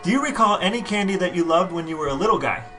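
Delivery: normal talking voice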